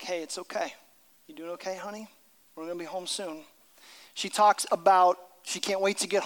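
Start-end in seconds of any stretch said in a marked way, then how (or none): 0.0-3.4 much softer, gentle reassurance; tender father. Retold or imitated. Father comforting his feverish daughter in hospital, tender. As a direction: soothe a sick child softly. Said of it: "And he'll say things like".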